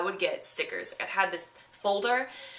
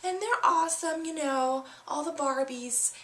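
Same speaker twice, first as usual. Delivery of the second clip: put-on high, gushing girlish voice with a gasp and laugh; excited little girl; 8 semitones higher than usual. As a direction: squeal like a thrilled kid showing off presents. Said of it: "And she's like".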